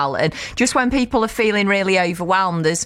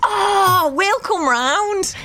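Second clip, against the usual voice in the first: excited; 11 semitones higher, much slower than usual. Real, not acted. Eager reply to movie night.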